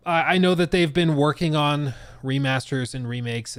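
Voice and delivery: normal talking voice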